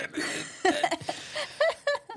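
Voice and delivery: squeaky high laugh; high giggle. Real, not acted. Laughing after emoji list.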